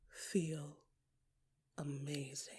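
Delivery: breathy near-whisper of wonder; whispered amazement; hushed awe. A narrator's reading. Awed hush about the ocean.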